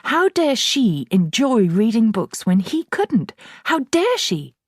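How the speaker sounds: slow, loud, high outraged father; furious father. A narrator's reading. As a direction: rage over every word. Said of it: "he seemed to be saying".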